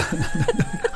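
short high giggle; real high-pitched chuckle; laugh. Real, not acted. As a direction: burst into a brief giggle. Laughing during banter about guessing.

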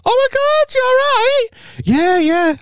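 very high, over-bright fake greeting; hammed-up squeaky delight, covering blankness; faked recognition. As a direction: gush as if you know exactly who it is. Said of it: "you try not to let out".

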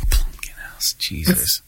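hushed disbelieving whisper. Real, not acted. Whispering the cohost's crude pun back in disbelief. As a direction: breathe it out, half shocked, half amused.

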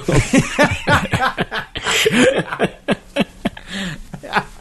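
high burst of real laughter. Real, not acted. Reacting to the film quote.

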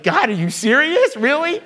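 incredulous protest to God; disbelief. Retold or imitated. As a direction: complain to God in mock shock. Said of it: "I was like".

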